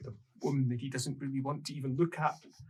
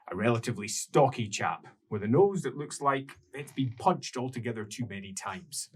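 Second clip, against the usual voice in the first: normal talking voice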